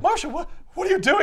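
can't believe what she's doing; stunned disbelief. Retold or imitated. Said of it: "And he's like".

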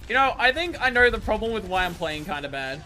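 normal talking voice

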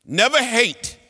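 emphatic appeal. Real, not acted. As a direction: exhortation. Urging students toward peace.

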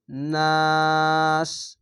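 নাস held long and cut off at stop; নাস said as a pause form; stopping form নাস. Shown as a demonstration. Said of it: "এভাবে থামবেন".

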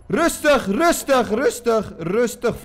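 high, loud repeated calls for calm; shouting to calm down. Acted. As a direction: yell at them to stay calm, easing off slightly. Schietpartij, roept om kalmte. (luid en hoog roepend: rustig)

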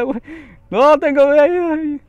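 high, loud, slow despairing cry; dramatic wail. Real, not acted. Gamer wailing that he has no health left. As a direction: wail it like it's the end.

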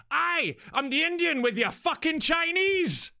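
loud, high, drawn-out Glaswegian boast; slow Glaswegian shout, pitched right up; Glaswegian accent. Retold or imitated. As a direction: imitate a Glaswegian delivery man announcing himself loudly. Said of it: "with a Glaswegian accent".

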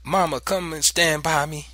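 put-on child's high, pleading call; begging. A narrator's reading. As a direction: beg mother to stay close. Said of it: "I begged".

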